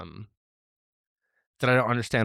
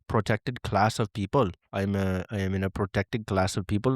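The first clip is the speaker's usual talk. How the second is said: put-on Indian accent. Mock announcement to viewers in an Indian accent. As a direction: play it as a proud Indian man making an announcement in an Indian accent. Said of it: "So when I do my Indian accent".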